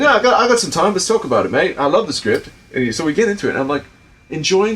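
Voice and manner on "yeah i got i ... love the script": higher, louder British impression; Clive's British accent. Imitating actor keen to discuss script, British accent. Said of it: "I don't wanna do a bad Clive impersonation".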